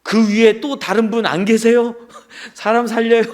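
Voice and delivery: lightly acted plea, laughing through it; calling out. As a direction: shout up with a comic twist. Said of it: "이렇게 다시 외쳤습니다".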